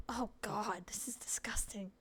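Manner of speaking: disgusted whisper. Real, not acted. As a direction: breathe it out in revulsion. Player reacts to the smell.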